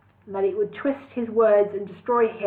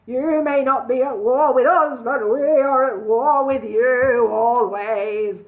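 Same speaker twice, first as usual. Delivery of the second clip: loud horrid goblin king; 3 semitones higher, 6 dB louder, slower than usual. A narrator's reading. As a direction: gloat over the beaten enemy.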